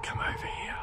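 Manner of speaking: eerie whisper; breathy ghostly whisper. Retold or imitated. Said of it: "somebody said".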